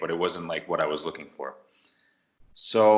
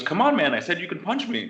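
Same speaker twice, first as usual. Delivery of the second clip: higher-pitched, mildly exasperated coaxing; retold urging; 9 semitones higher than usual. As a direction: goad a hesitant partner, voice raised in pitch. Retold partner urging him to punch.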